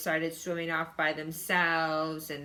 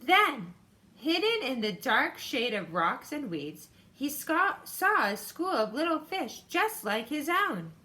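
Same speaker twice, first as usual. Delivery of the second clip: put-on bright, higher read-aloud voice for kids; story reading; 5 semitones higher than usual. A narrator's reading. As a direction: read it like a picture book to a class. Teacher reads story aloud.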